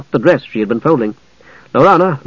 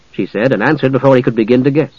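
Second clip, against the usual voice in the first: ordinary delivery, nothing marked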